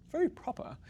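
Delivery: lofty, high-pitched RP English; proper British accent. Shown as a demonstration. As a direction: put on a prim British RP. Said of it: "like the British accent".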